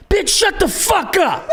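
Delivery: high, drawn-out mock yell; imitated angry heckler. Angry man heckles in retold story.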